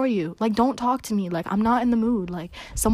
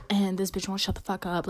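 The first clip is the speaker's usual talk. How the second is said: ordinary talk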